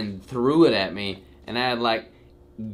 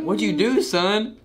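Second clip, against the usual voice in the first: parent voice; 9 semitones higher, 4 dB louder, slower than usual. Retold or imitated. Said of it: "Mom and Dad are like".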